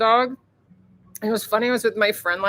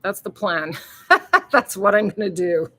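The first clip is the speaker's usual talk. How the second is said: ordinary talk, nothing marked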